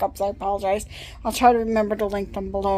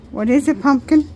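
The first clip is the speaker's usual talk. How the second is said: pet talk; 5 semitones higher, 8 dB louder than usual. Real, not acted. Cooing to the dog.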